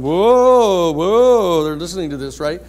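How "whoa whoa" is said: loud high drawn-out whoa; hammed-up astonished whoa; jury amazed. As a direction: gasp it out like a stunned crowd. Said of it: "the jury's all going".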